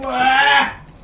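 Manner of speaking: drawn-out anguished wail; long pained yell. Real, not acted. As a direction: howl it out slowly. Burning mouth from tabasco. (長く苦しそうな叫び)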